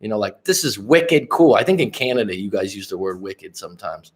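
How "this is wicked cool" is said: slow, higher mock Boston accent; drawn-out Boston accent, pitched up. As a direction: imitate thick Boston slang. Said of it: "they have this really thick accent".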